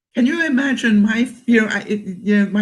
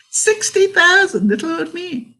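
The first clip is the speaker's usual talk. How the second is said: playful boast; 10 semitones higher than usual. Pretend. Boasting about her steps.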